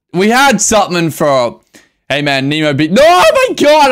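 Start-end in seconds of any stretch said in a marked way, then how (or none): 2.9-3.9 high, loud anguished cry. Real, not acted. Streamer losing her pets, loud high cry. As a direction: cry out in dismay.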